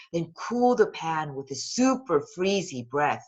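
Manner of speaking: plain reading voice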